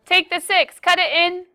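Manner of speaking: lightly put-on accent, teasing the class; unnamed put-on accent. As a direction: slip into a silly foreign accent for the steps. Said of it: "Don't know what accent that was".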